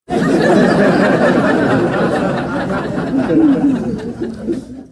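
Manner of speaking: high, loud helpless laughter. Real, not acted. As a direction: burst out laughing for real. Streamer cracks up at team.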